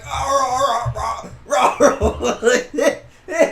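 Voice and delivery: loud, high wordless retching wail; mock crying gags. Storyteller mimics the kid choking on the sock, loud crying gags. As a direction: imitate gagging through tears. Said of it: "He's fucking crying".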